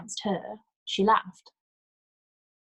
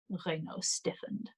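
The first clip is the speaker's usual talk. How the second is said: ordinary delivery, nothing marked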